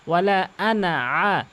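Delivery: ওয়ালা আনা drawn out; slow recitation. Acted. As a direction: chant the verse slowly. Recites verse slowly.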